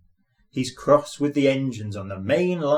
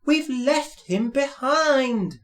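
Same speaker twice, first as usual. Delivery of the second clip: high, slow mock sob; sobbing together; 12 semitones higher, slower than usual. A narrator's reading. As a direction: wail it tearfully. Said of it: "sobbed Annie and Clarabel together".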